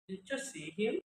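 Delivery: rising tune on "Did you see him?"; question intonation. Shown as a demonstration.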